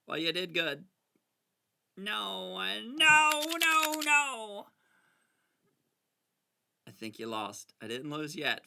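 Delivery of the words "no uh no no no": climbing to a high, loud wail; hammed-up cries of refusal; mock panicked protest. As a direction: wail the no's in rising alarm. Protesting as the game collapses.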